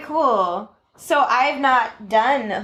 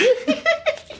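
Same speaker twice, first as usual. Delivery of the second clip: high cackling giggle; a short wicked little cackle; little evil laugh. Real, not acted. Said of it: "That sounded like a little evil laugh".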